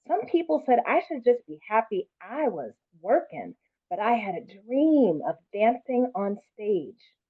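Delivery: put-on lively read-aloud, lifting for the child's voice; story reading. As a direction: read it warmly to a class. Reads picture book aloud.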